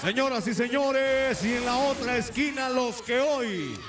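slow, shouted ring introduction; lucha libre announcer. Acted. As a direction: hype the crowd like a ring announcer.

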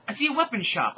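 high, loud eager yell. Acted. Heroine spotting the weapon shop, yelling high and loud. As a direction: call it out excitedly. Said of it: "I yelled".

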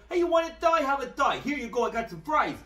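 hammed-up mock Italian accent, pushing a thigh on someone. Movie driver offers chicken to his passenger, in a mock Italian accent. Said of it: "And he's doing the accent".

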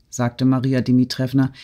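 usual speaking voice

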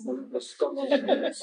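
high, slow, laughing declaration; laughing proud claim. Real, not acted. Scots woman laughingly declares herself Scottish, stretched and high.

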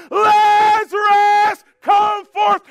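long drawn-out, high shout; loud cry. Acted. Jesus calling the dead man out of the tomb, loud cry. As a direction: call out at full voice, stretching the name. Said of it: "cries out in a loud voice".

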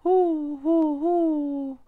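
high, hesitant little hoot; a shy ghost trying to howl; timid ghost howl. Acted. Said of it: "stimmte Heuler genauso leise ein".

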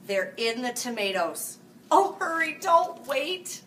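high, loud, urgent plea; child shouting in alarm. Acted. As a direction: yell at Grandpa to hurry. Grandkids urging Grandpa to hurry.

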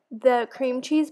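normal talking voice

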